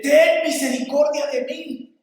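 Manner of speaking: imitated pleading cry. Blind man begging Jesus for mercy, crying out. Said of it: "más gritaba".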